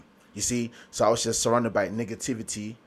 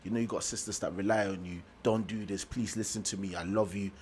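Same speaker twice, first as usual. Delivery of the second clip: soft, low, earnest plea; quiet, sincere reassurance, no dramatics; talking to her normally; 4 semitones lower, 8 dB quieter than usual. Retold or imitated. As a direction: gently talk a friend round, keeping it simple. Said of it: "I was just talking to her normal".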